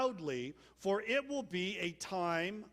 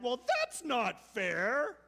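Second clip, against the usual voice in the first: slow, higher, louder whiny protest; mock whine; 5 semitones higher, 6 dB louder, much slower than usual. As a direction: drag out the unfair complaint. Us complaining when wronged.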